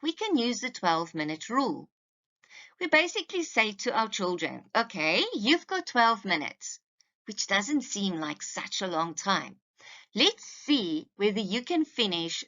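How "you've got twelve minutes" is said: ordinary delivery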